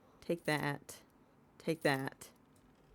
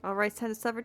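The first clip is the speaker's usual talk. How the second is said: ordinary talk, nothing marked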